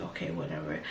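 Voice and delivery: low, soft, resigned mumble; dismissive. Retold or imitated. Narrator brushing off her boyfriend's plan, low resigned mumble. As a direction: brush it off, too tired to care. Tired, giving up on the argument.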